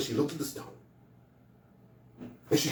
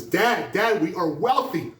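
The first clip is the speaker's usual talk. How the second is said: excited; 6 semitones higher, 4 dB louder, slower than usual. Acted. Boy runs home rich.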